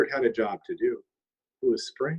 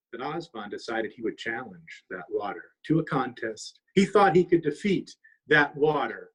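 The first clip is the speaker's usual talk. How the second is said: plain reading voice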